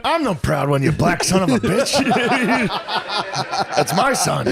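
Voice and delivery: high, laughing mock father bursting with pride; hammed-up proud dad. Riffing on the dad story, a high laughing mock proud father. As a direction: crow it like a giddy proud dad, cracking up.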